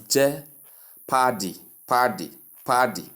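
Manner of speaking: "je-pardy" split, long AR in the second syllable; wrong stress. Shown as a demonstration. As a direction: mispronounce "je-pardy" slowly, leaning on PAR. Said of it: "placing the stress on the second syllable".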